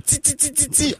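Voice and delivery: high, soft, rapid string of tsi; Quebec hissed T. Shown as a demonstration. Explaining the Quebec rule, ti said high as tsi. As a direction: ti said as tsi, with an s-like release. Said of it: "on ajoute un Z".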